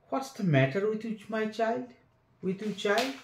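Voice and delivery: frightened aunt. A narrator's reading.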